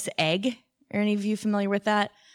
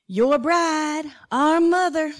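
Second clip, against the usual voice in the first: high, slow Southern twang; mock-indignant Southern kids; Southern accent; 8 semitones higher, 5 dB louder, much slower than usual. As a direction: imitate twins in a louder, higher Southern voice. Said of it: "in his Southern accent".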